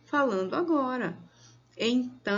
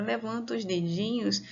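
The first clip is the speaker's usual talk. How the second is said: ordinary talk, nothing marked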